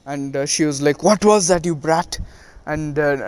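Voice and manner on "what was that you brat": retold offended outburst; indignant demand. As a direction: demand it crossly, voice raised then dropping. Retold fight, Tsunade knocked back.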